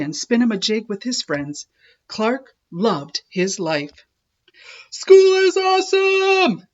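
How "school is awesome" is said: high, loud shark shout. A narrator's reading. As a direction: shout it with joy to the whole school. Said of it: "shouted Clark the Shark".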